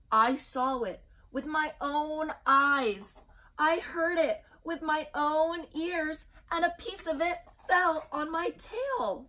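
high, loud, slow insistence; frantic little chick. A narrator's reading. Chick convinces Cocky Locky, high and loud. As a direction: plead that you saw it yourself.

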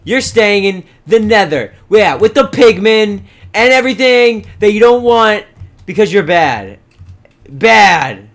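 loud, punchy scolding of naughty pets. Pretend. Gamer to misbehaving animals he is punishing, loud scolding. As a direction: scold the animals like a fed-up parent.

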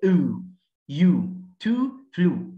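"أو، يو، تو، فلو" with a long oo; long U sound. Shown as a demonstration. Teacher showing how to read the symbol, long oo. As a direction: draw out the oo in "يو، تو، فلو". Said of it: "انطقها كده".

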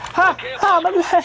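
very high yelps of pain. Real, not acted. Player with a painful leg, high-pitched yelping. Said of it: "Something about painful fucking leg".

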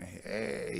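put-on soft, drawn-out drunk greeting; drunken Paul. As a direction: imitate an old drunkard's feeble slurred hello. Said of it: "he's sitting there all drunk".

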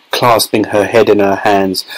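plain reading voice

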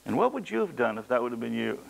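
ordinary talk

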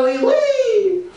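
high squeaky French oui oui; mock French. Mocking the French for the crowd, squeaky mock French. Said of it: "parlez-vous français à toi".

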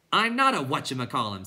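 moderately angry. A narrator's reading. Bastian correcting the clown, mildly angry and loud. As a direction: push back with mild anger. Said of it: "who by then was moderately angry".

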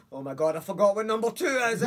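sudden panic, voice jumping high and loud; panicked. Retold or imitated. Telling himself on the bus, in rising panic. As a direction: gasp then panic as the mind goes blank.

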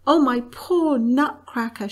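put-on a girl crying out for her friend in distress; crying out, sobbing. A narrator's reading. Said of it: "she cried, sobbing".

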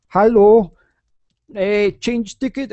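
rough imitation of a Russian speaker's basic English; Russian accent. Russian customer changing a ticket at a travel office, imitated Russian accent. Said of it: "attempting a, a Russian accent".

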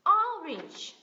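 orange with the O high and strong, then falling; stress first syllable. Shown as a demonstration. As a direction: punch the O of orange. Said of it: "cái âm o này mình nhấn này".

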